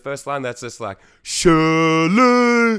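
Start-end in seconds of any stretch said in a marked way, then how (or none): 1.2-2.8 put-on "Shirley" sung loud and high in a thick English accent; [name]'s accent. Quoting a favorite song's first line in [name]'s accent. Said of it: "someone's name in this specific accent".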